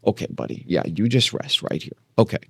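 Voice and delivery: soft, breathy murmur to settle him; soothing whisper to dog. Real, not acted. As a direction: lull the pup to rest in a whisper.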